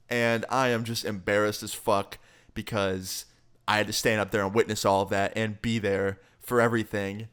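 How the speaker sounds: ordinary delivery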